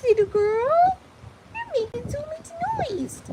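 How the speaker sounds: high sing-song scolding of the dog; playful high pet-talk telling off; high mock scolding. Real, not acted.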